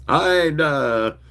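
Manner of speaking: mild flustered stammer. Acted. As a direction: trip over the start like a flustered doctor. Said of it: "he stammered".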